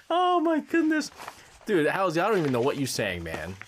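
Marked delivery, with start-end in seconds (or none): 0.1-1.1 high, laughing "Oh"; laughing exclamation. Real, not acted. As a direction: burst out through the laughter. Laughing at the cover again.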